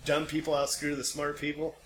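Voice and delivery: ordinary talk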